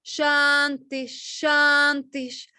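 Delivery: "Shantish" slowed down, with the final "sh" held and hissed; Shiva ending. Shown as a demonstration. Teacher demonstrating the sandhi sound to the student, slowly. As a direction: land "Shantish" on a strong, lingering "sh". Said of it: "You hear that?".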